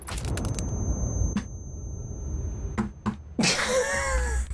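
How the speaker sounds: wheezing high silent laugh; helpless laughter. Real, not acted. Streamer dying again, cracking up in wheezing laughter. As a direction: laugh till no sound comes out.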